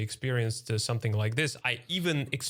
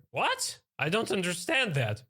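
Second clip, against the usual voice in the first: mock baffled shop assistant; 7 semitones higher than usual. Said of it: "she goes like".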